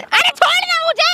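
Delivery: high, slow, stretched whining yell; yelled protest. Acted. Woman to man turning her face, yelled protest. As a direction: drag out a high cry to be let go.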